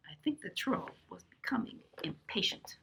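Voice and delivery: whisper. Real, not acted. Aside to children.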